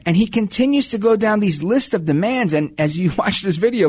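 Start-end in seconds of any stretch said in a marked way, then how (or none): none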